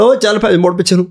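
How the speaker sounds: high-pitched mock command; imitating villager's order. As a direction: order a child back, lightly acted. Villager orders the boy back. (गाँव वाले का नकली हुक्म)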